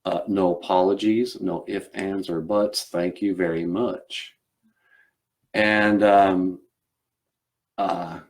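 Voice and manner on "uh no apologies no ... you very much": earnest Southern gentleman endorsing a cause; Southern accent. Acted. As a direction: proclaim it firmly in a Southern accent. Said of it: "And he was like".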